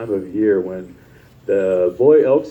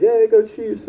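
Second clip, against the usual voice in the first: fan cheer; 11 semitones higher, 3 dB louder than usual. Retold or imitated.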